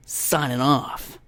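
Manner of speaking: slow, drawn-out DJ voice; radio host sign-off. Acted. Podcaster parodying a morning DJ, slow sign-off voice. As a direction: stretch it out as a mock sign-off. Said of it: "they sign off".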